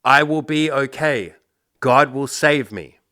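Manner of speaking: high, loud, slow shout skyward; man yelling up. Retold or imitated. Man on the roof yelling up to the pilot, slow loud refusal. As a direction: shout up to the helicopter, stubborn. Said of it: "yells up".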